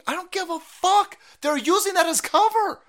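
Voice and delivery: high, loud exasperated outburst; angry retort. Real, not acted. Host answers the official's defenders, angry retort. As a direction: snap back hard at the excuse.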